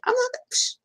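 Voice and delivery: put-on high, far cocky kid voice. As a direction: brag it like a kid who's got it.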